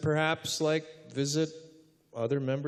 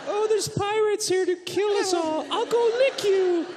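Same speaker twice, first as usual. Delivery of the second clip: high, silly happy dog; goofy dog voice. Retold or imitated. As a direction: play the dopey friendly dog. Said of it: "he's like".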